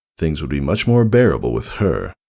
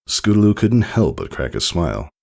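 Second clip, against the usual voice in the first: usual speaking voice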